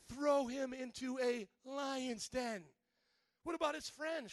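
normal talking voice

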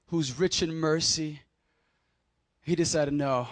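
ordinary talk, nothing marked

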